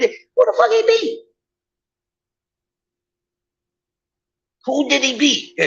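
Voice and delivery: high-pitched, exasperated disbelief; incredulous outrage. Real, not acted. Host disputes an opponent's ranking, incredulous high protest.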